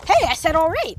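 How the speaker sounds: ordinary talk, nothing marked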